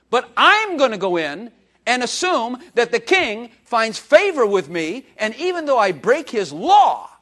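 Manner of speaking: loud, high, slow preaching climax; slow, loud and rising preacher's cry; full-force preaching. Acted. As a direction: build the sermon to its peak.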